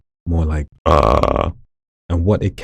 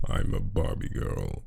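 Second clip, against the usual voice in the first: very low, slow, softer creaky fry; vocal fry; 9 semitones lower, 8 dB quieter, slower than usual. Shown as a demonstration. Showing voice number two to viewers, in heavy vocal fry. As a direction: let the words rattle in creaky fry. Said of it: "lots of vocal fry".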